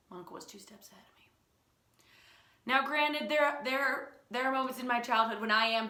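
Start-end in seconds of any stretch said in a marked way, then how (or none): 0.1-1.3 breathless whisper, fast; whispered aside. Real, not acted. Telling listeners her uncle outwitted her, in a whisper. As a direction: confide it under your breath.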